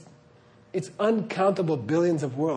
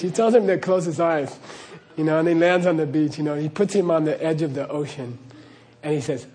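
normal talking voice

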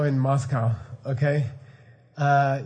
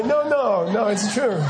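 higher, laughing, insisting; laughing insistence; 6 semitones higher, 3 dB louder, slower than usual. Pretend. A future person insisting to a doubter, laughing. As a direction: laugh while swearing it really happened. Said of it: "they'll say".

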